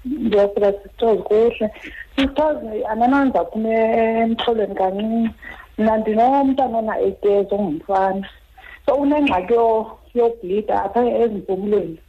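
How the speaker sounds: phone call. Real, not acted. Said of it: "Asakhe naye emnxebeni".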